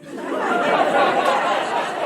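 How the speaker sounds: high unvoiced laughing burst; loud burst of laughter. Real, not acted. Laughing at Hades joke.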